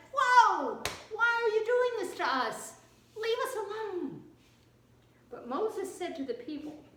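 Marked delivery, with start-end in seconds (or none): 0.1-4.2 very high, loud cry of alarm; panicked protest. Acted. Israelites see the army coming and turn on Moses, panicked protest. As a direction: yell it in hammed-up panic.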